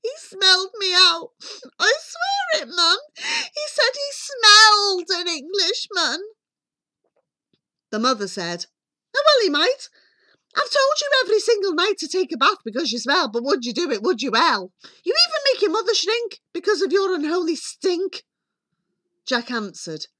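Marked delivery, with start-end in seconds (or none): 0.0-6.2 high, loud, breathless wail; panicky boy. A narrator's reading. Frightened Jack insists to mother. 9.1-18.2 high, rapid-fire nagging; scolding mother. A narrator's reading. Mother scolds Jack in story.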